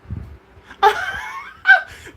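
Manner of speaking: shrieking high laugh; a sudden whooping cackle; laughing shout; loud burst of laughter. Real, not acted. Bursts out laughing at her own joke.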